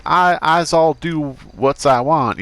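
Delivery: put-on a clumsy stab at a Southern accent; bad southern accent. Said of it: "a real southern, I can't do a southern accent".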